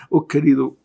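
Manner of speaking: usual speaking voice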